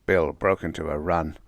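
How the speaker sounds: ordinary delivery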